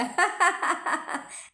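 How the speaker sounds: risada alta e aguda; gargalhada. Real, not acted. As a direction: solta uma gargalhada. Laughs at her own remark. (risada alta, espontânea)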